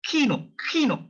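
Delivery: high-pitched "Kino" with puff after k; sharp aspirated K. Shown as a demonstration. Teacher models the German cinema word, sharp K. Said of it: "very sharp sound".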